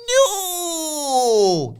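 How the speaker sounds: high, loud put-on cry of no; mock outraged cry. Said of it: "Noam says".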